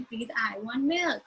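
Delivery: slow, drawn-out sing-song request. Shown as a demonstration. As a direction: stretch it out like a nursery rhyme. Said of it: "that sing-song manner".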